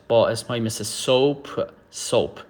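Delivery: normal talking voice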